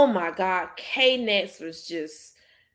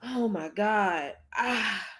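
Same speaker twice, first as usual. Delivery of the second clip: choked up; 7 dB quieter, much slower than usual. Real, not acted. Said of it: "I'm still pissed off".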